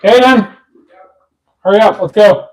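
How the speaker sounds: loud call across the house; hurrying someone. Real, not acted. Calling son to dinner.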